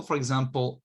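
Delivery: ordinary talk, nothing marked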